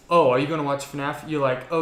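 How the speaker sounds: ordinary delivery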